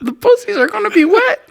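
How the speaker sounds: squealed mock excitement; high-pitched, whiny declaration; hammed-up squeal; high whiny parody. Parody character's outburst.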